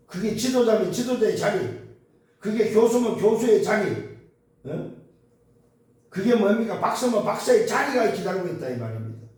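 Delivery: high, loud, emphatic sermon delivery; impassioned preacher, pitched high and loud; full-force preaching. Real, not acted. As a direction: drive each point home to the congregation.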